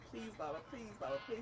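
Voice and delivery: retold begging. Teller replaying pleas to Baba, soft begging. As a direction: beg softly, as if replaying it. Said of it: "bitch, I was begging".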